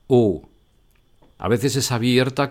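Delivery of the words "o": O said as a short, closed French vowel. Shown as a demonstration. Teacher demonstrates the closed French O sound. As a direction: round the lips into a closed O. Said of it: "esa O sea cerrada".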